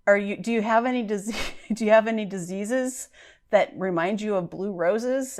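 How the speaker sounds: mock a clumsy Southern mother from a school play; very bad Southern accent. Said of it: "in a very bad Southern accent".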